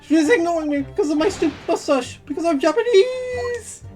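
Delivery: indignant outburst. Acted. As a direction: build to an outraged yell. Indignant witness complains loudly.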